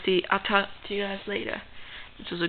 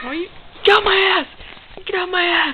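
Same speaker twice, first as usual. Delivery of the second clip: angry shout; 10 semitones higher than usual. Acted. Spider-Man yelling at Godzilla biting him during a fight. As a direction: scream at the biting dinosaur.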